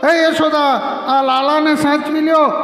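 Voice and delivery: put-on a girl calls for Yashoda to come take the child; calling out. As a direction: call to the mother across the yard, pitched high. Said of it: "રાધાએ બૂમ પાડી કે".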